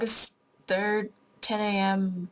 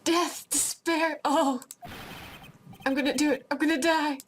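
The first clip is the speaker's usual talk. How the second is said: melodramatic despair; 10 semitones higher than usual. Acted. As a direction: wail like it's the end of the world. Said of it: "It sounds like he wants to die".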